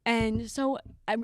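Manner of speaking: normal talking voice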